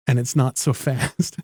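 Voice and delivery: ordinary talk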